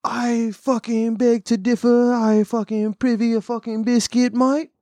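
put-on high, drawn-out caricature British accent; hammed-up high-pitched British accent; mock British accent. Ridiculing how funny Brits sound, mock British accent. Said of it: "funnier than a fucking British accent".

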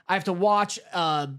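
normal talking voice

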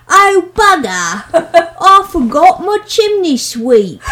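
loud, high, drawn-out London sweep; hammed-up East London chimney sweep shouting; chimney-sweep English accent. Shown as a demonstration. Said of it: "the chimney sweep".

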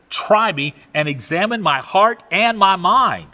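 usual speaking voice

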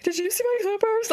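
high, frantic question; panicked. Retold or imitated. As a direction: ask in a high panic, near hyperventilating. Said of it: "literally hyperventilating".

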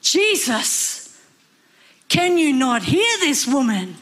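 high, loud fed-up complaint; acted annoyed pleading to be rid of someone; exasperated disciples. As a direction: whine in exasperation, swooping high on the key words. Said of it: "the disciples said".

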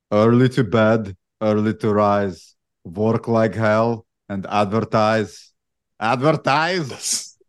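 slow, heavy Austrian-accented action-star impression; hammed-up Austrian accent, last word punched; Arnold Schwarzenegger. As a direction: do an amateur Austrian strongman, drawing out the words. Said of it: "in an Arnold Schwarzenegger, uh, accent".

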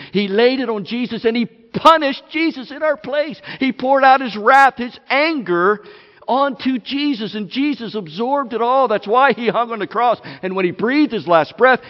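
loud, pounding preacher's voice; fiery sermon build; full-force preaching. Acted. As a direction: hammer each line home to the room.

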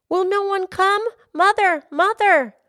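high, loud cry for mother; desperate shout. Acted. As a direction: call out for help, frightened. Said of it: "He shouted again".

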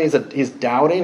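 normal talking voice

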